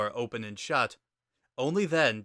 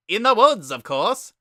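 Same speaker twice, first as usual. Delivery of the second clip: high, loud, booming skeleton brother; loud high cartoon brother voice; Papyrus voice; 9 semitones higher, 9 dB louder than usual. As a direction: bellow it out like an eager kid brother.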